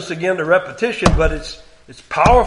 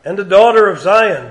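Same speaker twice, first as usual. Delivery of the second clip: raised, deliberate King James reading; solemn scripture reading; 4 semitones higher, 7 dB louder than usual. Acted. Preacher reading God's words to congregation, raised. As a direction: read it as God's complaint against Israel.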